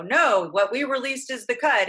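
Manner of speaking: mock-proud studio verdict, slow and emphatic; prideful refusal. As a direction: put your foot down, proudly. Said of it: "could have been prideful and said".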